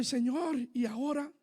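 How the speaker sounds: quiet retold question, rising on the address; retold helpless plea. Man turning to God with no work, quietly at a loss. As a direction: softly ask God, voice dropped. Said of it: "Y, y yo".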